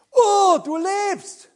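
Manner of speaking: slow, high, loud cry of relief; he's alive, let the joy burst out slowly; overjoyed cry. Acted. King overjoyed Daniel survived.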